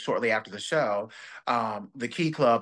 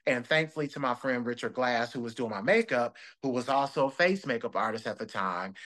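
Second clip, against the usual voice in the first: usual speaking voice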